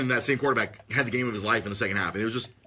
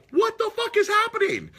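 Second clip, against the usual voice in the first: much higher, disbelieving yelp; stunned crowd; 4 dB louder than usual. Retold or imitated. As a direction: squeak it out in total disbelief. Crowd reacting to the comeback.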